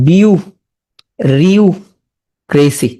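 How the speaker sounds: "বিউ", "রিউ", "ক্রেসি" with long pauses; slow, separated chunks of "বিউ- রিউক্রেসি"; syllable-by-syllable breakdown. Shown as a demonstration. Teacher showing how it breaks up, slow separate syllables. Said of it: "তাহলে এটা পরে কি হবে".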